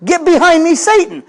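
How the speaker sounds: high, sharp rebuke; Jesus rebuking Peter. Acted. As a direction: snap it out at Peter like a stern teacher. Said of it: "Jesus says to Peter".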